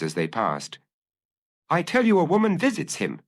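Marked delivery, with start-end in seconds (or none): 1.7-3.2 mildly heated, higher voice; a touch of urgent anger; lightly acted violent insistence. A narrator's reading. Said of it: "Knight was saying violently".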